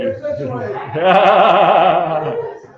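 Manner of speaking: big burst of laughter; loud laugh. Real, not acted. Laughs mid auction call.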